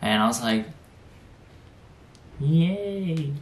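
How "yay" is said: ordinary delivery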